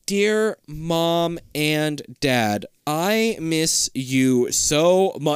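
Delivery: very slow, word by word; writing out loud. Acted. Mocking someone who says a letter aloud, slowly sounding it out. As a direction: drag out each word as if writing it. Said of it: "who writes a letter like".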